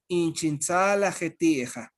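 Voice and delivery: "Inchintzalaje tieja" said slowly and evenly; careful model phrase. Shown as a demonstration. Teacher models the phrase for students, slow and careful. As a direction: lay out each syllable for learners. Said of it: "lo que le dijo Jehová".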